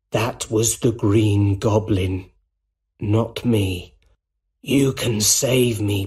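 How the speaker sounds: slow, coaxing villain begging for help; a defeated man bargaining slowly; pleading Norman Osborn. A narrator's reading. Voices dying Norman Osborn.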